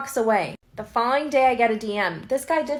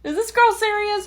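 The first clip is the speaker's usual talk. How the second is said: shocked disbelief; 10 semitones higher than usual. Retold or imitated. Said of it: "He was shook".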